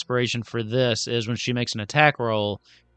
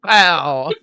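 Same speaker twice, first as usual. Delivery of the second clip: loud, raised echoing exclamation; playful shouted sound effect; comic-book pow; 4 semitones higher, 7 dB louder than usual. Acted. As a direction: shout it like a cartoon punch.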